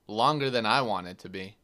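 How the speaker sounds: ordinary talk, nothing marked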